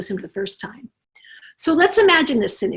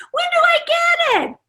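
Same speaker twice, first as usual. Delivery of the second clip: put-on high, loud child's whine; demanding child; 3 dB louder than usual. Child demanding the truck back, high loud whine. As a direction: shout it like an impatient kid.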